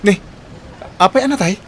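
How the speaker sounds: sharp, high, loud alarm; jolted by the bad news; high-pitched startled asking; shocked question.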